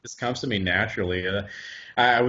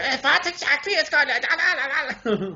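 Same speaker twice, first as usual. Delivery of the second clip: higher, slower, exaggerated Hollywood German accent; 14 semitones higher, slower than usual. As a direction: ham up a movie German accent. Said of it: "the Hollywood say".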